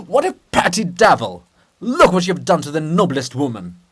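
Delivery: loud raging rebuke; furious shout. Acted. Andrew raging at Bulgakov's monument, loud furious shout. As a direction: roar at the petty devil.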